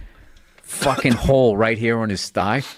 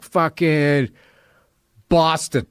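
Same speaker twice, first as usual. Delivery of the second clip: put-on slurred, drawn-out drunk answer; drunk and blunt; 2 semitones higher, much slower than usual. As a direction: say it wasted and defiant. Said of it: "That's not the way I said it".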